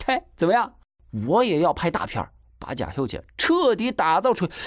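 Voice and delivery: put-on high, excited, cocky boy; smug boasting. A narrator's reading. Boy brags he'll film a blockbuster, smug boasting. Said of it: "金锁还在得意的张扬着".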